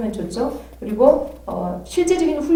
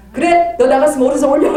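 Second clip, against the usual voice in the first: higher, louder command with a laugh; commanding shout; 5 semitones higher, 8 dB louder than usual. Retold or imitated. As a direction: test the spirit loudly. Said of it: "그럼 오른손 딱 올려".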